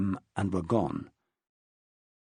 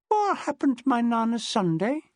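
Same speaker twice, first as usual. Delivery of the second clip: put-on sleepy little boy; 8 semitones higher than usual.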